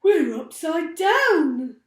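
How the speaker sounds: loud, slow, stunned gasp; Mister Twit gasping. A narrator's reading. Mister Twit realises they are upside down, loud stunned gasp. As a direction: gasp it out in disbelief. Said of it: "gasped Mister Twit".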